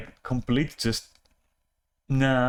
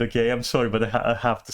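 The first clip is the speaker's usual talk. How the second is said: usual speaking voice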